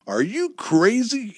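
wife challenges her husband's crazy plan; demanding. A narrator's reading. Wife demands, disbelieving. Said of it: "Amy demanded".

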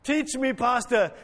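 mildly hammed-up congregant call; imitated calling out. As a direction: imitate an eager heckler from the pews. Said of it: "Grace will yell out".

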